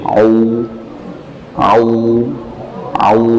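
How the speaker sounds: Au with lips pushed forward; rounded au demo. Shown as a demonstration. Tajwid teacher to students, rounded Au demo. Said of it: "memonyongkan bibir".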